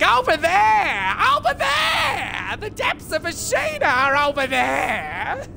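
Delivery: put-on high, slow wailing old witch; kooky crone. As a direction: cry it out like a Wizard of Oz crone. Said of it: "she sounds like a kooky crone".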